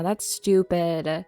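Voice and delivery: drawn out very slowly; slow, deadpan self-verdict. Real, not acted. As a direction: stretch each word of That's stupid. Repeats a self-judgement.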